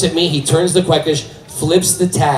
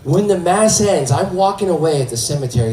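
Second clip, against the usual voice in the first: ordinary talk, nothing marked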